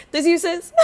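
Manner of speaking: high loud taunting this you; mock her with a loud sassy gotcha; sassy call-out. Calling out broker's false listing.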